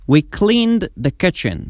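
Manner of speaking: very slow, careful English sentence; slow model reading. Shown as a demonstration. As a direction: read it slowly for students to copy. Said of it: "slow model sentence". (خواندن آهسته و شمرده)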